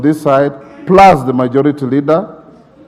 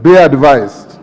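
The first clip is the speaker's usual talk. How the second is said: usual speaking voice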